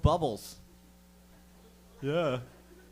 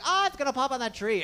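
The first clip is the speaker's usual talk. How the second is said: mock worry; 9 semitones higher than usual. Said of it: "We're like".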